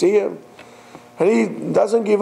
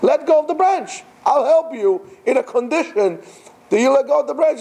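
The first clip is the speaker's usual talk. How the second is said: higher insistent repeat with a laugh; insistent command; 9 semitones higher than usual. Retold or imitated. God repeats his order to the climber, higher. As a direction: press the order again, amused. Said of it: "repeated command".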